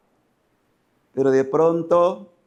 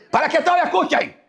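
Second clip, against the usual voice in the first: high, fast preacher's shout; real shouting; 13 semitones higher, 3 dB louder, faster than usual. Real, not acted. Preacher defending his shouting to the congregation, high fast shout. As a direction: shout it so everyone hears. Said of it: "los discípulos de Cristo gritan".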